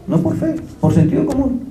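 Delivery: a high, emphatic comeback in the retold debate; insistent retort. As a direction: cut them off: not by faith, by common sense.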